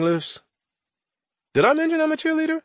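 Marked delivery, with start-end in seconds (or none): 1.5-2.6 high mock aside.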